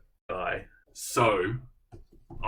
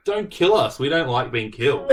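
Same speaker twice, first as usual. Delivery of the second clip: much higher, louder, hammed-up protest cry; mock protester plea; 6 semitones higher, 9 dB louder than usual. Retelling a refugee's protest as a loud mock plea. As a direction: shout it like an exasperated protester pleading. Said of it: "went to a protest to basically say".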